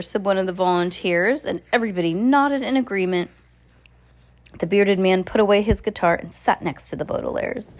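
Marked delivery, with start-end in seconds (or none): none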